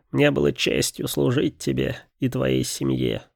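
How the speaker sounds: a faithful bird saying farewell; raven speaking. A narrator's reading. Raven bids farewell to Robert, lightly voiced bird. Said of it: "сказал Ворон".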